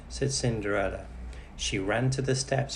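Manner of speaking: plain reading voice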